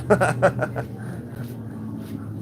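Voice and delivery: rueful chuckle trailing off breathily; pained laughter. Real, not acted. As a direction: laugh off a painful loss. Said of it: "I'm laughing when I say that".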